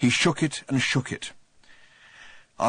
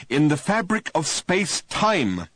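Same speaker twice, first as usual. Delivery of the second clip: higher, louder patient overpronouncing; slow and distinct; 6 semitones higher, 3 dB louder, slower than usual. A narrator's reading. Ford explaining to Arthur, slowly and distinctly. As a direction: spell it out like to a slow clerk. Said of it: "as slowly and distinctly and patiently".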